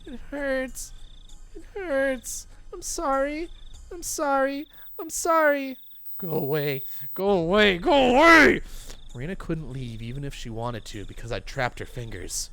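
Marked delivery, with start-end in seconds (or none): 0.1-5.8 much higher, growing louder pleas; desperate apologies. Acted. Rena repeating sorry to Keiichi, high and pained. 6.2-8.6 from plain to much louder shout; building yell. Acted. Keiichi yelling at Rena, building to a shout.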